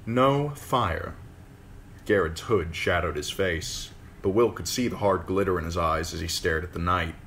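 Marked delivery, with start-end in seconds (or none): 0.0-1.1 "No fire" snapped high, final fall on "fire"; curt command. Acted. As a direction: clip "No fire" as a flat order. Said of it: "mouth became a hard line".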